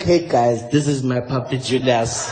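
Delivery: very low, slow puppy owner; Jacob Zuma. Acted. Zuma introduces his puppy to the others, deep mock voice. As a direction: drop right down into a deep politician impression. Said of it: "like Jacob Zuma brought us a little puppy".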